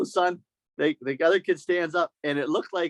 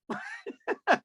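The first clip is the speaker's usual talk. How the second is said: high laugh; 6 dB quieter than usual. Real, not acted. Laughing after imitation.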